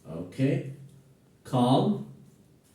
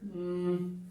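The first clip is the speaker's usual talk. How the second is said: ordinary talk, nothing marked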